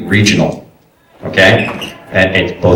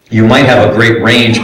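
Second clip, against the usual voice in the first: normal talking voice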